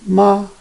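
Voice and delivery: "Ma" high and level; first tone. Shown as a demonstration. Teacher reading the four tones, first "Ma" high and level. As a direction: hold "Ma" steady on a high note. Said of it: "read those four tones all together".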